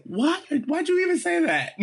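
very high, incredulous mock outcry; baffled group reaction. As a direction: imitate everyone's disbelief, voice shooting way up. Acting out everyone's baffled reaction.

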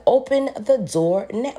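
high, loud and slow, leaning on open; loud demand. Retold or imitated. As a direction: shout it at the clerk, every word spaced. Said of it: "screaming this at the top of my lungs".

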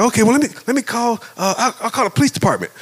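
high, hurried retold fluster; flustered, upset. Husband deciding to call police, acted-out fluster. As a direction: scramble for a plan, pitched high. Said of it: "So I'm all upset".

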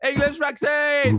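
high, hammed-up patriot refusing to budge; mock Brexiteer. Mocking people refusing the Pfizer vaccine, hammed-up Brexiteer.

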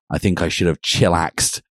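plain reading voice

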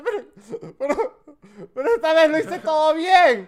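half-laughing plea to the usher; mock whiny plea; mock pleading. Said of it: "de empezar a llorar y decirle".

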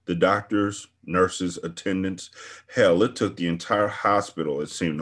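ordinary delivery

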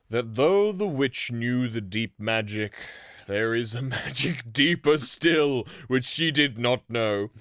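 slow, loud and solemn; solemn Aslan. Acted. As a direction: proclaim it slowly like a wise king. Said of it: "said Aslan".

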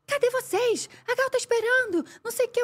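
high, urgent voice of a caller demanding where they are; mock irritated phone voice; angry caller on phone. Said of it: "o produtor atendeu o telefone".

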